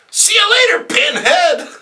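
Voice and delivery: very high shouted farewell; high shrieking goodbye; villainess taunt. Acted. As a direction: fling the insult as you leave. Felicity's exit taunt.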